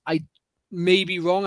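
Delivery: normal talking voice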